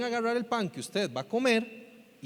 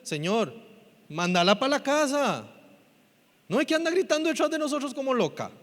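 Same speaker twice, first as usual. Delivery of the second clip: mild imitated complaint, rising higher; 6 semitones higher than usual. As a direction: plead to have someone sent away. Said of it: "sus discípulos le dijeron". (discípulos quejándose, imitados)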